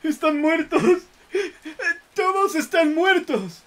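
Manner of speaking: high, wailing shriek of terror; terrified imploring scream. Acted. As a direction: beg in pure horror. (alarido de terror suplicante)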